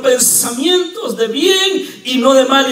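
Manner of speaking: high, loud, slow "pensamientos de bien y no de mal"; full-force preaching. Acted. Pastor repeating God's words to the church, slow and loud.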